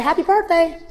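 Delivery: high, put-on cheery greeting; reenacted chirpy well-wishing; birthday greeting. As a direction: sing out the greeting.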